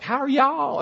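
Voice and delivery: high Southern woman's sweet greeting; a charming Southern lady's hello; Southern accent. Retold or imitated. Southern wife greets Bostonians in a high, sweet Southern accent. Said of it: "A woman speaks up".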